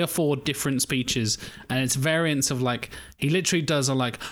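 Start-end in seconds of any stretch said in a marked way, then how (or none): none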